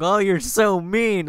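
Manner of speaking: high, slow, mocking whine; whiny mock complaint. Mocking a newbie who asked for critique, high whine. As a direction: whine it like a hurt teenager. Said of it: "mocking the whiny critique-seeker".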